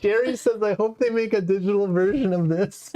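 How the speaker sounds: high-pitched, laughing read-out. Real, not acted. Host reading chat comments about the game, laughing. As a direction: read it out through real laughter, voice riding high.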